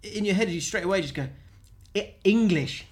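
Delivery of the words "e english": mock tourist, raised and higher; talking louder. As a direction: push the word out at a foreigner, laughing. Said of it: "You just, you just talk louder".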